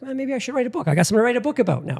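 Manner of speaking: thinking aloud, suddenly inspired; excited idea. Retold or imitated. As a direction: blurt the idea out fast. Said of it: "And I'm like".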